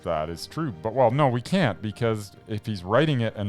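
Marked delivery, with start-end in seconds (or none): none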